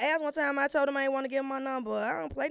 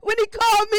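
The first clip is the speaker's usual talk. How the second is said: ordinary delivery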